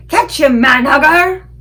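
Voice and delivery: loud high giant shout. A narrator's reading. Flesh Lump Eater shouting while tossing the BFG. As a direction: hurl the call across the field.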